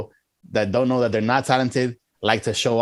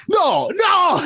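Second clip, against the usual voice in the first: high, loud imitated scream; mock scream; 5 dB louder than usual. Imitating Al Pacino's scene after the share sale, mock scream. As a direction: cry it out high, hammed-up. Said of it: "he's, like, screaming".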